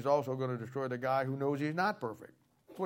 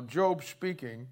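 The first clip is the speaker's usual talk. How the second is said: ordinary talk, nothing marked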